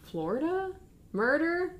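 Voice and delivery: high, rising questioning words; feigned cluelessness. Retold or imitated. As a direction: play puzzled, pitch climbing on each word. Zola feigns ignorance on call.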